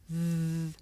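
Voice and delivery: Th. with tongue tip between the teeth; th sound. Shown as a demonstration. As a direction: show Th. as a slow held buzz. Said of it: "Now you try. Watch, listen, and repeat".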